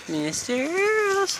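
high sing-song call, slow and drawn out; high pet call. Real, not acted. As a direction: coo his name up high like calling a pet. Calling out to his pet.

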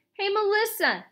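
high-pitched hail; calling out. A narrator's reading. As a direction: call to a classmate across the room. Ryan calls to a classmate.

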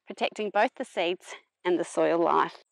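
ordinary talk

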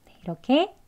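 usual speaking voice

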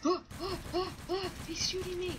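high-pitched panicked cries; panicked yelp. Real, not acted. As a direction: yelp in real panic under attack. Being attacked in game.

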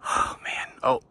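breathless whispered awe after running; out of breath. Real, not acted. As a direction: gasp it out, winded. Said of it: "that's why I'm out of breath".

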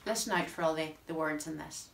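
ordinary talk, nothing marked

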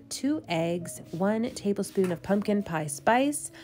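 normal talking voice

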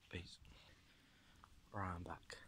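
hushed whisper on return. Real, not acted. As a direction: keep it barely voiced. Back after hiding from passerby.

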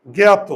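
জ্ঞাত said loud as "ggyato" with a rounded o ending; teacher stresses জ্ঞাত ending in o; correct pronunciation. Shown as a demonstration. Teacher to pupils, showing জ্ঞাত ending in o, loud. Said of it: "আমরা ত বলি".

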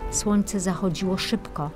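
ordinary delivery

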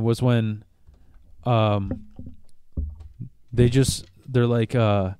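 usual speaking voice

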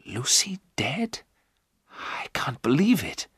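hushed, stunned disbelief; said quietly. A narrator's reading. Jonathan hearing that Lucy is dead, quietly stunned. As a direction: draw it out slowly, half whispered. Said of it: "Jonathan said quietly".

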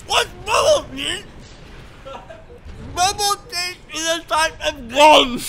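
high, loud yell through a mouthful; gum-muffled, high-pitched outburst; exasperated yell. Real, not acted. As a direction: shout it garbled round the gum. Explaining through gum, exasperated, cracking up.

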